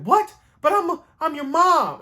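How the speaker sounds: high, stunned mom voice; can't believe what she's hearing; an outraged mother's squeak of disbelief; shocked disbelief. Retold or imitated. Mom told she can't come.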